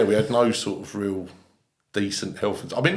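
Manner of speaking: ordinary talk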